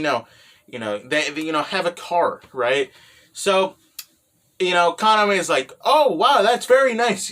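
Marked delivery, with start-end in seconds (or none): 5.8-7.3 higher, louder mock gushing; impressed reaction. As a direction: act out a delighted "oh, wow". Acting out Kaname's impressed reaction.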